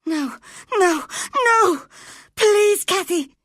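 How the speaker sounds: put-on terrified boy screaming; wild scream. A narrator's reading. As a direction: scream in panic. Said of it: "screamed Linton wildly".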